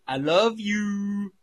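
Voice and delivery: high, loud, long-drawn declaration; quoted lyric. Retold or imitated. Quoting a favourite bit of the song, drawn-out mock declaration. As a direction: stretch it out loudly, high-pitched. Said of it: "that bit where it goes".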